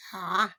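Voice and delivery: A said loudly from the throat, as the Arabic ain. Shown as a demonstration. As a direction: push A out from the throat. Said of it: "prononcer Ain avec la voyelle A". (A prononcé fort, de la gorge)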